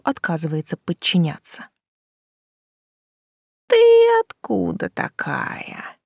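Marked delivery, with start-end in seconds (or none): 3.7-6.0 wet lisp. A narrator's reading. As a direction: lisp it wetly like a rotting monster. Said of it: "влажно прошепелявил монстр".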